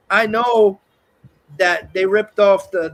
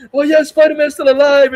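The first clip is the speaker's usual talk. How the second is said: higher, louder glad cry; crowd believing; 6 semitones higher, 7 dB louder than usual. Retold or imitated. Crowd outside believing he survived, glad and louder. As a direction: sound like a fan relieved he lived. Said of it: "all the people believing".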